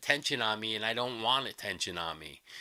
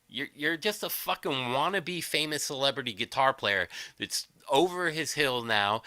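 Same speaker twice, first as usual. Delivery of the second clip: ordinary talk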